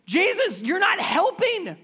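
high, loud, exasperated complaint; accusing disciples. Retold or imitated. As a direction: blame him for not helping. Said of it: "the other way they could have asked".

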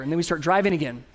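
usual speaking voice

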